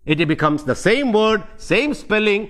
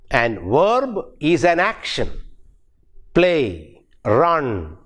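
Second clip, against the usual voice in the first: ordinary talk, nothing marked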